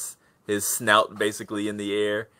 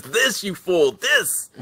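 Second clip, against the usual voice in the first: high, loud scornful jab at the fool; scornful goblin; 10 semitones higher, 5 dB louder, slower than usual. Acted. Goblin mocking the player over scat, high and scornful. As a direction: shove it under his nose with contempt.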